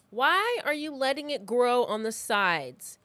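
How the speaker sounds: usual speaking voice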